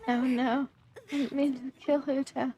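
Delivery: ordinary delivery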